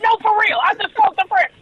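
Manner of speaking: high and emphatic, pleading to be believed; insistent excitement. Retold or imitated. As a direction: insist it's true, still buzzing. Insisting to disbelieving mother.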